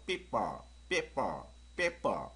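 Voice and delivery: 삐빠 with popped double P; two short syllables, 삐빠, lips pressed then popped; tight P sound. Shown as a demonstration. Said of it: "여기서 발음처럼".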